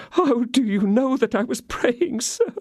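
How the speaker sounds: very high, mock-sobbing question; voice choked by sobs. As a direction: fake the sobs to win him. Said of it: "in a voice suffocated by sobs".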